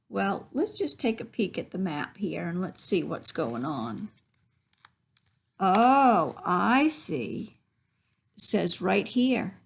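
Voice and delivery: ordinary delivery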